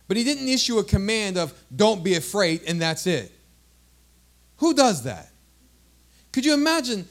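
loud, emphatic sermon delivery; forceful preaching. Real, not acted. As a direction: preach it out to the congregation. Preaches to congregation.

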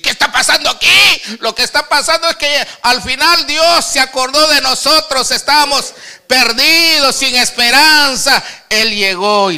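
loud, high, insistent sermon delivery; full-force preaching. Real, not acted. Preacher declaring his testimony to the brothers, full-force preaching.

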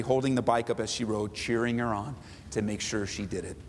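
normal talking voice

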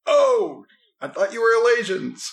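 loud, high-pitched character voice; sudden realization. Acted. Crossbowman learning they aren't Elasians, loud high surprise. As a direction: burst out high at a mistake dawning. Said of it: "And he's like".

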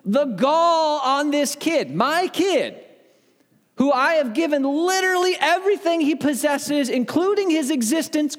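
high-pitched, hammed-up indignation; offended. Father retelling how his own kid offended him, mock-outraged. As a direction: sound outraged at the sheer nerve, stretching key words. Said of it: "I was offended".

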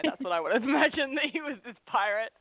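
high, laughing delivery; tickled by your own fanciful idea; amused laughing. Real, not acted. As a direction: chuckle through the words, pitch lifted. Amused aside about pirate ancestor.

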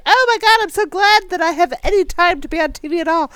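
high gushing thrilled voice; gushing grateful. Retold or imitated. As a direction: gush like a grateful newcomer. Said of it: "the first two seasons are like".

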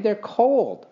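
put-on high-pitched boy's complaint; startled exclamation. A narrator's reading. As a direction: complain at the sudden cold. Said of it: "he exclaimed".

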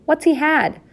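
high, piping question; puzzled child. A narrator's reading. Young mouse misunderstands euphemism, puzzled. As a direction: ask it high in innocent confusion.

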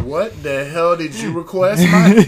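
loud, slow stern father; stern dad. Retold or imitated. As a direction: scold like an annoyed dad. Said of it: "your dad said".

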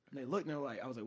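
normal talking voice

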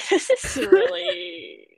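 high, stretched words through a laugh; slow, giggly disbelief. Real, not acted. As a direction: let the laugh lift the words. Laughing at the characters' enthusiasm.

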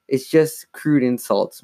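ordinary delivery, nothing marked

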